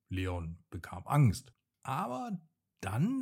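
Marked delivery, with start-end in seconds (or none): none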